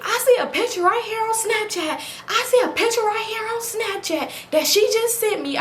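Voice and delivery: high, pointed accusation; angry. Retold or imitated. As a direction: throw the proof at him. Confronting with evidence.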